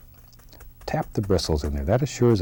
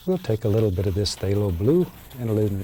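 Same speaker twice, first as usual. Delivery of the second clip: ordinary talk, nothing marked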